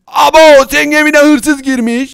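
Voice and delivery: startled cartoon outcry; alarmed shout. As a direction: shout a warning in mock panic. Cries that a burglar got in.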